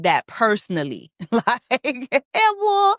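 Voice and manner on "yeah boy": hyped exclamation. Real, not acted. Hyped reaction to open bar.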